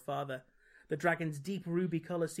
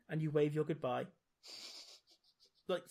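usual speaking voice

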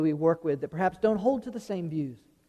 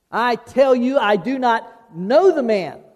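emphatic denial; 4 semitones higher, 6 dB louder, slower than usual. Retold or imitated. Peter's third denial retold.

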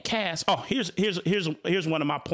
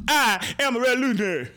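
imitating the movie line, higher and faster; mock the film's delivery, pitched up; mimicking the actor; 6 semitones higher, 4 dB louder, faster than usual. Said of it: "the part where he goes".

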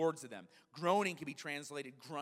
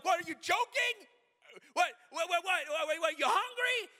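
high, loud, comic disbelief; hammed-up baffled dad; frantic incredulity; 8 dB louder than usual. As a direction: stammer out wild guesses at the top of your pitch. Acted dad frantically guessing child's words.

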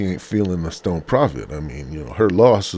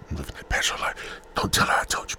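whispered confession to the listeners; hushed, breathy secret; keeping quiet; 4 semitones lower, 4 dB quieter, faster than usual. Pretend. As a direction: whisper it behind someone's back. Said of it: "I gotta be quiet now".

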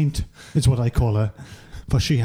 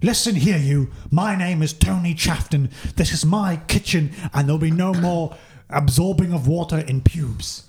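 high indignant shouting chef; angry chef voice; 4 semitones higher than usual. Acted. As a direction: scold them like a fussy chef. Character Tony Chafton confronting.

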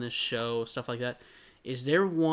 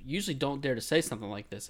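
ordinary delivery

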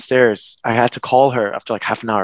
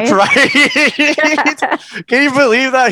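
high laughing burst through the words; 14 semitones higher, 5 dB louder than usual. Real, not acted. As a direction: laugh out the question, louder and higher. Laughing over father's joke.

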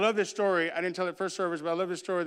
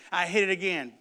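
normal talking voice

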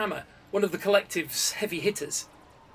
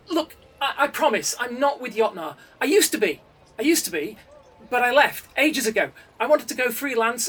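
higher, louder pleading with a catch; panicked pleading coder; 7 semitones higher, 6 dB louder than usual. A narrator's reading. Accused coder pleading with the council, panicked. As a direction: beg them to believe you. Builder pleads his innocence.

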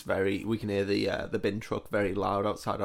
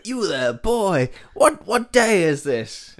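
lordly, slow, higher call from the window; posh gentleman calling out; 8 semitones higher, 5 dB louder, slower than usual. Pretend. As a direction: hail the bin man like a Victorian toff. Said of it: "I go".

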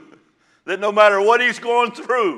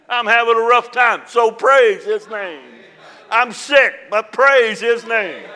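louder, slow, laughing shouts of praise; joyful shout of praise; 3 semitones higher, 6 dB louder than usual. Acted. Preacher showing how to praise God on a hard day, joyful shouts. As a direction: lift it up with a laugh.

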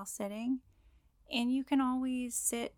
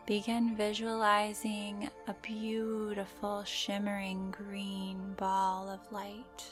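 guided meditation; slower than usual. Acted. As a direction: gently guide the listener into calm.

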